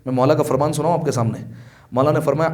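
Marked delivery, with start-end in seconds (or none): none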